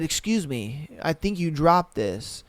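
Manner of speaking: a quiet, courteous imitation; gentle new guy. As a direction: hand it back to her politely. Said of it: "he said".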